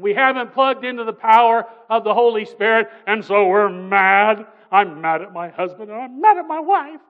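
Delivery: loud, heated preaching rant; mad spouse rant. Acted. Preacher acting out spouses mad at each other, shouting. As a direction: shout out the griping of angry spouses.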